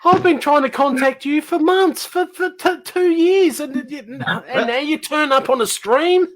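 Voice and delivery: high-pitched, stammering exasperation; indignant disbelief. Acted. Host scolding guest missing for two years, exasperated. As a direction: complain in a high, half-laughing outburst.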